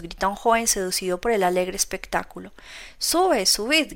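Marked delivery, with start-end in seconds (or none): 3.0-3.9 higher eager shout. Acted. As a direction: wave them up onto the cart. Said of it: "grita mi Colca".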